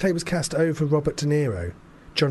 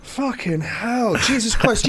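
exasperated outburst; 9 semitones higher, slower than usual. Real, not acted. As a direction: throw up your hands at yourself. Exasperated at himself.

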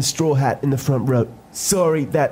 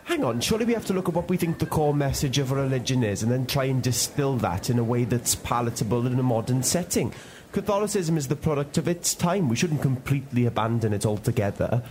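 softer, rushed reasonable murmur; quiet aside; 3 semitones lower, 6 dB quieter, faster than usual. Acted. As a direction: slip it in under the breath. Reasonable point muttered quietly.

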